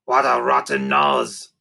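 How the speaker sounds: low, slow creaker growl with the wrong word. A narrator's reading. Narrator voicing Sniff, stumbling on the word, in a low slow creaker voice.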